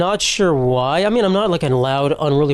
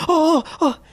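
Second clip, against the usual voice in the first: high mimicked gasping "oh"; mock-startled little high cries; startled maid; 12 semitones higher than usual. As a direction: imitate someone caught by surprise. Maid startled on opening the door.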